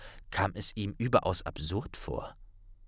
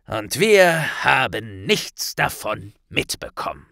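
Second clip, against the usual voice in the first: a lightly voiced solemn minister; grave Kingsley; 3 semitones higher, 6 dB louder than usual. A narrator's reading. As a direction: minister briefing Harry and Hermine, grave. Kingsley explains.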